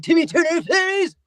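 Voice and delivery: unhinged, high-pitched cartoon outburst; Crocker. Actor demonstrates his crazy character, high manic voice. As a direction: shriek it like a crazed cartoon villain. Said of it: "Crocker's out of his mind".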